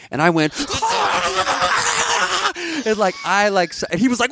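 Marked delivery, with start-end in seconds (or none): 0.6-2.8 laughing mock scream. Her scream at the brother.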